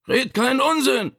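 high-pitched strained call; indignant shout from the bedroom; Vito. A narrator's reading. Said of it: "rief Vito".

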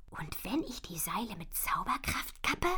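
langsam flüsternd überlegen; nachdenklich geflüstert. Acted. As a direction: leise mit sich selbst grübeln. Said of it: "überlegt sie". (nachdenkliches Flüstern)

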